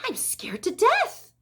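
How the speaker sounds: high, slow, fretful hush; mock-frightened high voice, drawn out; scared whisper. A narrator's reading. Mrs. Zuckerman scared before the watching crowd, high and fearful. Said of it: "whispered Missus Zuckerman".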